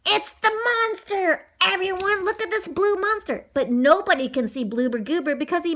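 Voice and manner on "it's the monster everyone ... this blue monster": high-pitched excited alert; urgent call-out. A narrator's reading. Boy pointing out the monster to teammates, urgent call-out. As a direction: get everyone to look, voice pitched up.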